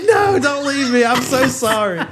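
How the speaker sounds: high pleading voice, half laughing; mock crying plea. Boyfriend on hands and knees begging his girlfriend, high mock sobbing plea. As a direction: beg her not to leave. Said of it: "he's crying".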